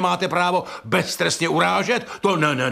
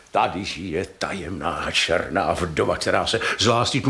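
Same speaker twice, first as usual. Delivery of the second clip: mock romantic thought; 11 semitones lower than usual. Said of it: "a pomyslí si".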